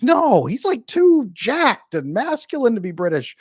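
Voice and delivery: hammed-up no-way tone; mock protest. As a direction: play up the joke of your own silly claim. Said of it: "I was like".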